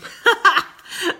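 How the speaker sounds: high, loud burst of laughter through tears; tearful laugh. Real, not acted. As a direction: release a sudden laughing sob. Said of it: "I've been holding it in".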